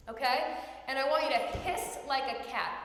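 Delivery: normal talking voice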